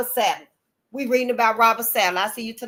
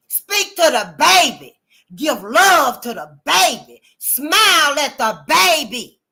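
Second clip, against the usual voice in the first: slow emphatic instructions; 4 semitones higher, 6 dB louder, slower than usual. Real, not acted. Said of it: "slow deliberate instructions".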